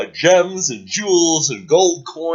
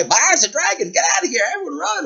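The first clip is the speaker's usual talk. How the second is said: high, frantic screaming townspeople; loud panicked cries of a crowd; panicked townsfolk; 4 dB louder, much faster than usual. Acted. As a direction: shriek it like villagers fleeing. Townsfolk fleeing the dragon.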